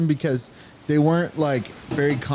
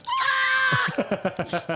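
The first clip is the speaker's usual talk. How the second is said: mock scream. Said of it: "his insides are like".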